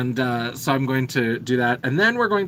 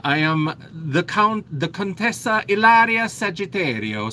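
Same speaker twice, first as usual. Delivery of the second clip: put-on a fairly terrible Italian accent, pitched up; 4 semitones higher than usual. Host introduces her character in an Italian accent. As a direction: announce her title in a hammed-up Italian accent. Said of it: "I have to do another accent that's gonna be fairly terrible".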